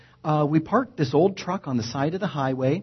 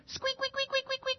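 high, soft squeaking window imitation; squeaky window; 11 dB quieter than usual. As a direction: mimic a squeaking car window. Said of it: "window squeak down".

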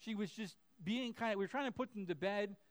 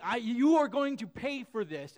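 mock kid threat; 4 semitones higher, 9 dB louder than usual. Kid threatening sibling after getting hurt.

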